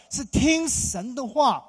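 slow, loud, each word stressed; emphatic. Real, not acted. Pastor urging parents, slow and emphatic. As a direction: lay it down word by word. Preacher exhorts congregation.

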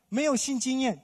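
usual speaking voice

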